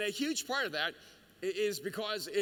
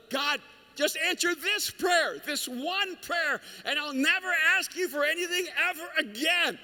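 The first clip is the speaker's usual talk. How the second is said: desperate bargaining prayer; 8 semitones higher, 5 dB louder than usual. Retold or imitated. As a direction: beg God like it's your last hope.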